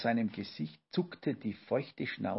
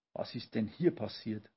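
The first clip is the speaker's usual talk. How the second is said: ordinary delivery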